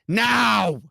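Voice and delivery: high, drawn-out shout; demanding yell; impatient demand. Real, not acted. Impatient demand for another accent.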